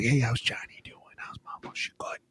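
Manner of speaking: hushed whispered check-in. Retold or imitated. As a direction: lean in and whisper it privately. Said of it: "he'd come up to me and be like".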